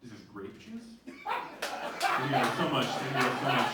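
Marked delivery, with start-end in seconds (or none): 0.0-0.8 hushed question from a struggling drinker, lightly voiced; a soft, low, rising query, as a recovering alcoholic in church. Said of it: "impression of a re-, of a recovering yet struggling alcoholic".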